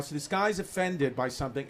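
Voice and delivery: ordinary delivery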